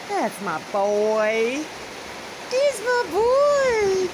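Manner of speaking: slow, drawn-out loving praise; doting praise. Real, not acted. Praising her pet bird, slow and doting. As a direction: stretch the words out fondly.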